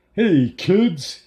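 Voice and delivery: high, loud cartoon party clown; screechy clown voice. A narrator's reading. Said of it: "he screeched in a stereotypical clown voice".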